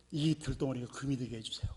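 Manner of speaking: hushed, intense whisper to God; whispered prayer. Retold or imitated. Poor man on his knees whispering a prayer to a stone. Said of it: "그렇게 막 기도를 했습니다".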